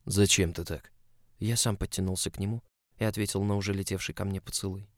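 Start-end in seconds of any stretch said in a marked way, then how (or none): none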